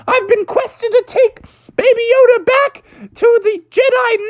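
high, frantic, near-tearful squeak; panicked squeal. Acted. Mando panicking over the lost kid, high frantic squeal.

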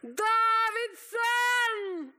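long, high, full-voiced holler; piercing drawn-out cry for attention. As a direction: yell it out, stretched almost into song. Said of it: "han ropte bare enda høyere".

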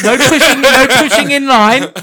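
loud, laughing crowd-control calls; bossy queue marshal. Pretend. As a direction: keep the line in order.

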